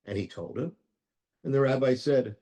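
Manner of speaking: ordinary delivery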